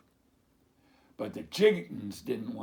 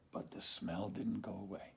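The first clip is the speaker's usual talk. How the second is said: much softer, whispered; hushed letdown; 4 semitones lower, 9 dB quieter than usual. A narrator's reading. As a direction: whisper the failure.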